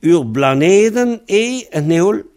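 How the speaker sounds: Ur, blaneden et neol? high and slow, rising at the end; quiz question. Shown as a demonstration. Teacher asks the student a new quiz question, high and slow. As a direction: pose a trick question to the student. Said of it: "une autre question".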